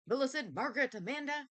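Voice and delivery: high, loud call across the cottage; calling the child from afar. Acted. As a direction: call her full name out. Same name called out.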